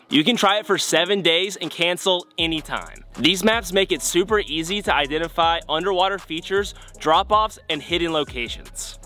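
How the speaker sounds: polished sponsor plug; sponsor ad read. Acted. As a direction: sell it to the viewers with lift on key words.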